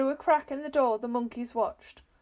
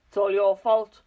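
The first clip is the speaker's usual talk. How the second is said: loud furious yell; husband yelling blame at his wife; 2 semitones lower, 9 dB louder than usual. A narrator's reading. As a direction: blast the blame at her. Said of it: "yelled Mister Twit".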